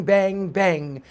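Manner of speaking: plain reading voice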